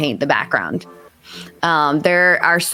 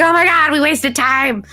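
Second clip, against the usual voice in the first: hammed-up alarmed cry; mock freak-out; 9 semitones higher than usual. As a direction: act out girls panicking over lost time. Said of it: "They start freaking out".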